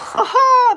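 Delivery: high, loud burst of laughter. Real, not acted. Streamer laughing at his messy bed, loud laugh. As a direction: crack up in one big burst.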